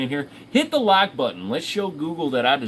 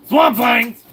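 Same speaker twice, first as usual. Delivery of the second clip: loud, high, far-reaching shout; calling out; 10 semitones higher, 9 dB louder than usual. Real, not acted. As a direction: yell the name across the room to the fans. Calling out to the chat.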